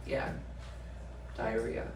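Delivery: very soft, low mock-crying voice; tearful imitation. Crying mom answering the cop about grandma, soft mock-tearful. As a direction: imitate a weepy, barely audible admission. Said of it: "crying, she's like".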